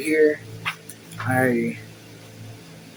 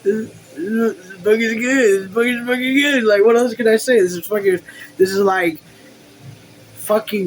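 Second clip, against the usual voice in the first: higher, louder gushing praise; excited; 6 semitones higher, 3 dB louder than usual. Real, not acted. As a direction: rave about it, can't contain it. Reader gushes about the book.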